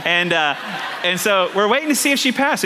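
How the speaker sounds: ordinary talk, nothing marked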